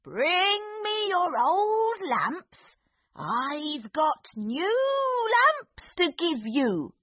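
put-on high, slow, wavering old man; old peddler voice. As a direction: croak out a peddler's call. Disguised magician calling lamps.

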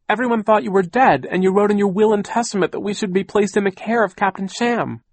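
high, loud, urgent accusation; crying out. A narrator's reading. Niece confronting aunt about her will, crying out. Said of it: "Violet cried".

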